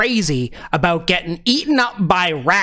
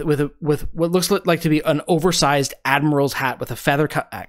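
ordinary delivery

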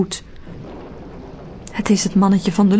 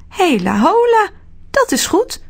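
little man Arthur; 10 semitones higher than usual. A narrator's reading. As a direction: cheer it like a tiny man. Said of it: "zegt Arthur".

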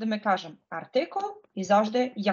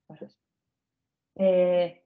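ordinary talk

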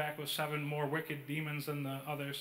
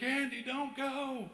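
pleading; 9 semitones higher than usual. Retold or imitated. Friend running after him as he walks home, pleading. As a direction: imitate a scared friend begging company.

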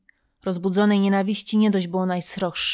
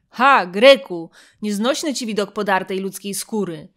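mocking jeer; 7 dB louder than usual. Mocking the Greek at the games.

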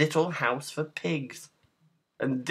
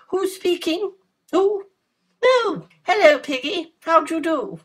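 put-on very high, loud girlish voice; 7 dB louder than usual. A narrator's reading. Girl greeting pig on the phone, very high voice. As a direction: chat on the phone in a very high character voice.

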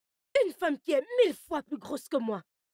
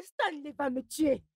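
ordinary talk

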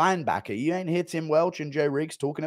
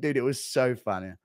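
ordinary talk

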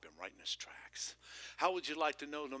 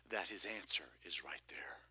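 whisper; 8 semitones lower, 6 dB quieter than usual. Real, not acted. Preacher confides the point.

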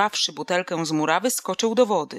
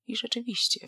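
usual speaking voice

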